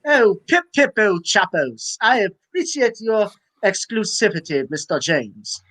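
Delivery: put-on a quick jab at a British accent, slowed; mock British. Cohost joining in, badly faked British accent. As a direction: toss off a bad British "pip pip". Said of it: "that was a little s-, Spanish there".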